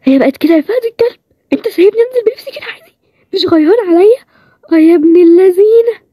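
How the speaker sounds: a voice she doesn't recognize as her own; strange high voice. Acted. Waking in a strange high voice, baffled at it. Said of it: "وانا بتكلم كده ليه".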